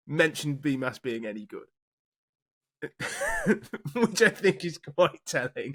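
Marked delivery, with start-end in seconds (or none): 2.8-5.7 laughing, slowed, higher. Real, not acted. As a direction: say it while still laughing. Laugh heard, far, slow.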